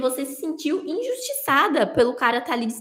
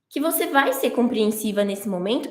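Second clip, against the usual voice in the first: ordinary delivery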